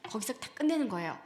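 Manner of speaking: usual speaking voice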